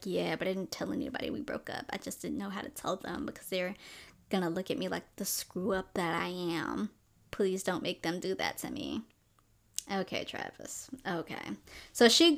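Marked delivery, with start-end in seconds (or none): 0.0-9.0 hushed, pleading Travis voice, much softer; quiet pleading. Retold or imitated. Boyfriend begging ex to meet family, hushed and pleading. 9.9-11.5 breathy, resigned, much softer; a defeated little sigh of yes; breathy giving in. Retold or imitated. Girl giving in to her ex's plea, breathy and resigned.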